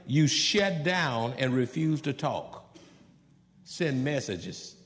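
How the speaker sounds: ordinary delivery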